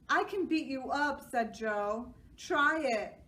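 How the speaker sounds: put-on loud childish threat; kid threat. A narrator's reading. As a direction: squabble loudly like kids. Kids squabble.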